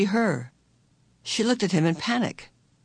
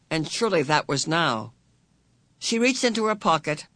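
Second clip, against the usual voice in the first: ordinary delivery, nothing marked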